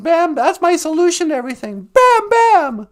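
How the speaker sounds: club-swinging cartoon yell; acted cartoon shout. Acting out Bam Bam clubbing.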